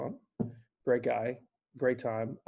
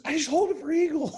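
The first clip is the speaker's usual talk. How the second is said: high, louder call across the course; triumphant yell; 6 dB louder than usual. Retold or imitated. Friend turning to shout his eagle, high triumphant yell. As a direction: shout the news back up the hill.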